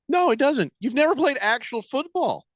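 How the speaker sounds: mocking disbelief, voice jumping high; incredulous retort. Real, not acted. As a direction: shoot the claim down in disbelief. Scoffing at the rugby player's claim.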